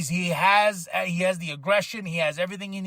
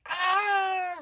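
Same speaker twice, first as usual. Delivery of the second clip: very high, drawn-out surprised cry; imitating his own surprise; startled exclamation; 12 semitones higher than usual. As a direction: caught completely off guard. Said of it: "'Cause I was like".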